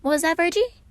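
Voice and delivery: high, loud mock innocence; teasing Patton. A narrator's reading. Patton teasing Virgil over the mumble, high. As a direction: sing it back teasingly, high.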